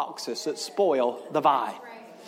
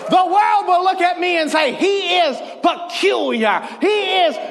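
higher and louder, fiery preacher cadence; full-force preaching; 9 semitones higher, 4 dB louder than usual. Acted. Pastor preaching to his congregation, shouting with fire.

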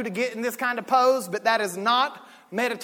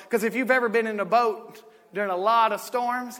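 ordinary delivery

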